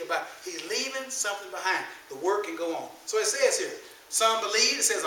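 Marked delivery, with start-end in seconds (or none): none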